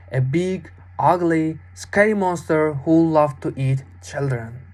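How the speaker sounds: ordinary delivery, nothing marked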